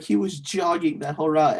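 retold amazement. Co-host quoting his friend's text about the play, amazed. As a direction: relay a friend's amazement, pitch lifted.

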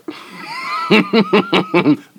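long, high burst of giggles; high cackle. Real, not acted. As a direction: crack up helplessly. Cracking up at the pronunciation.

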